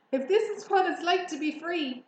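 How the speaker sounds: high, loud complaint; dismayed thought. A narrator's reading. Young pig fleeing his pursuers, dismayed. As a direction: protest as the overwhelmed little pig. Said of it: "he thought".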